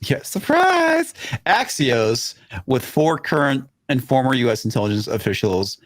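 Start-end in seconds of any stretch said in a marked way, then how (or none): 0.0-1.1 sarcastic. Real, not acted. Sarcastic reply to no comment.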